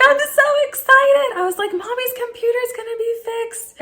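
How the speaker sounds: very high, squealing with joy; telling the kids in a squeal; giddy excitement. Retold or imitated. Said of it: "I was so stoked".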